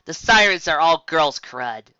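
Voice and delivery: slow, dry, scornful; slow sarcastic put-down; sarcastic drawl. Acted. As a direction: drag it out with scorn. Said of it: "drawled Yellow Eyes sarcastically".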